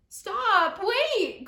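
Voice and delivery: high, loud call to stop them; crying out. Acted. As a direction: shout after the scattering family. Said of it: "cried Moose".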